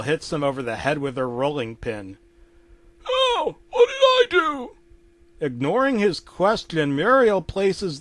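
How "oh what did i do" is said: very high pained whine; Eustace protesting. Acted. Eustace just hit with a rolling pin, high protest. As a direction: cry out in a high, indignant voice.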